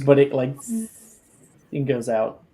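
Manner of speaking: usual speaking voice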